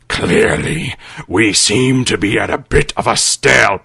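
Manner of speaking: low, dry necromancer voice; low villain voice. Acted. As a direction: say it low and unimpressed. Necromancer speaks.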